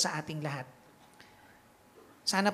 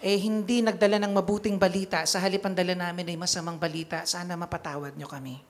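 louder, raised preaching voice; impassioned preaching; 3 dB louder than usual. Acted. Priest to congregation, preaching with full force. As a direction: drive the point home to the flock.

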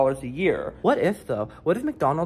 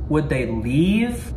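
ordinary talk, nothing marked

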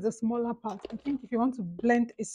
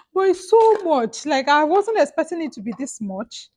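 shocked exclamation; 5 semitones higher, 7 dB louder than usual. Real, not acted. Shocked at the price.

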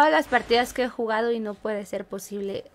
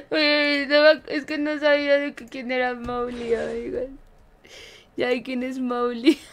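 words squeezed out through real laughter, a bit louder; laughing while talking; 4 dB louder than usual. Real, not acted. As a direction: keep giggling as you explain. Talks through laughter after mistake. (hablando entre risas)

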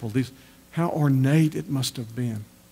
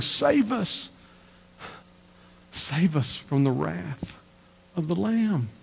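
crying out; 4 semitones higher, 3 dB quieter, much slower than usual. Retold or imitated. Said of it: "will cry out for rocks to crush them, saying".

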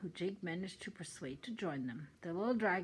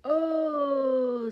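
long, loud, high "oh"; sustained high cry; mock sobbing wail; 8 semitones higher, 12 dB louder than usual. A narrator's reading. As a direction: wail it out in dismay. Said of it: "sobbed the dragon".

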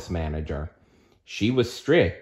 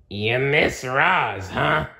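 slow, drawn-out growly tease; a sly monster growl, slow; 6 semitones higher, slower than usual. Acted. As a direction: growl it slowly from the doorway. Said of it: "a low, growly voice said from the doorway".

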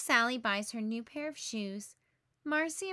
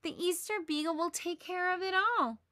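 put-on higher, fervent boy's voice; earnest believer; 7 semitones higher than usual. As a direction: insist with total faith. Linus insisting to friends.

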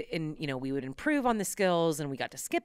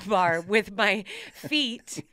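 usual speaking voice

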